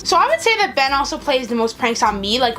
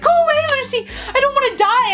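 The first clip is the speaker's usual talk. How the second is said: acted frightened plea, pitched way up; retold freak-out; 14 semitones higher than usual. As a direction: shoo the cat in mock terror. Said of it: "I was, like, freaking out".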